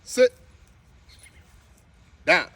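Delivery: sharp, loud command to dog; firm loud command. Real, not acted. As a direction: bark it out firmly.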